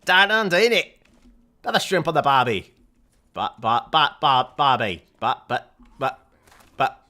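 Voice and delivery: loud, high, hammed-up Australian accent; deliberately wrong Braveheart impression, shouted broad Australian; Mel Gibson. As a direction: belt out a silly Aussie caricature. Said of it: "do your best Braveheart impression".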